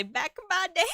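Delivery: high mock old-timer catchphrase; old man. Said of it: "where I just say".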